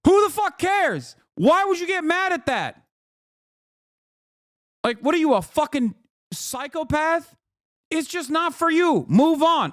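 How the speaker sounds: high-pitched incredulous rant; exasperated, high-pitched scolding; mock outrage. Mock outrage at an imagined complainer.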